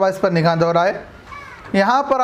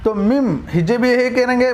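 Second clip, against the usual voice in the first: normal talking voice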